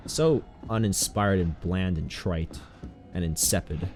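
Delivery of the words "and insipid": normal talking voice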